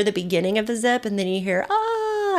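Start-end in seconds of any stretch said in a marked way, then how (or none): 1.7-2.4 high "ah" trailing away; scream trailing off. Retold or imitated. Unseen rider vanishing down the longest line, scream trailing off. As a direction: imitate a distant zip liner's cry. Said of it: "it kinda trails off".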